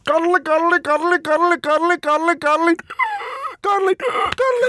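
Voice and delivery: high-pitched repetitive silly voice; playful high chant of nonsense; silly noises. Pretend. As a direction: squeak the word over and over like a toy. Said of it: "Let's make some more noises".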